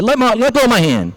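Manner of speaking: high-pitched, indignant demand; angry rabbit. Acted. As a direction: act out a stuck rabbit demanding release. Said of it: "He's getting furious".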